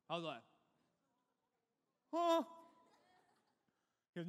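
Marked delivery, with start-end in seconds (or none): 2.1-2.4 high, loud startled yelp; startled huh. Retold or imitated. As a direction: jump at the accusation. Startled reply to bully.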